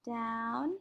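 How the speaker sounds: DOWN held long, lifting at the end; drawn-out DOWN. Shown as a demonstration. Teacher leading pupils as they skywrite, drawn-out DOWN. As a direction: stretch DOWN to match the stroke. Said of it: "Let's try it one more time".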